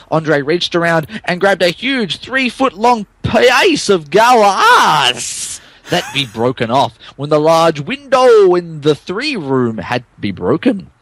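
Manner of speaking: ordinary talk, nothing marked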